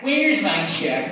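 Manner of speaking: loud, slow, whining complaint; whiny voice. Acted. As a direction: whine it like a pestering client. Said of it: "whiny voice".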